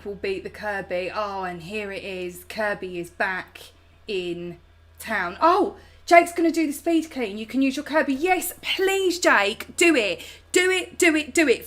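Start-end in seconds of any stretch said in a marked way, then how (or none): 5.4-11.7 excited. Real, not acted. Cheering Jake to speed clean.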